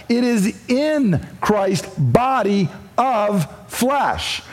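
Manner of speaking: BODY OF FLESH spaced out slowly, word by word; slow, deliberate weight on each word of "body of flesh"; emphatic. Acted. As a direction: drive home "body of flesh" with slow, separated words. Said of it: "I'm going to say it emphatically".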